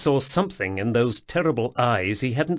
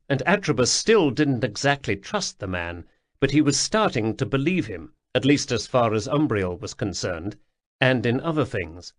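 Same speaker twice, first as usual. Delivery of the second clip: ordinary delivery, nothing marked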